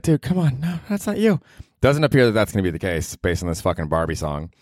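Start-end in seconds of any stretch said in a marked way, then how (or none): 0.0-1.4 imagined protest. Pretend. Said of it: "I'd be like".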